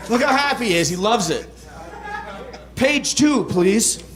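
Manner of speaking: ordinary delivery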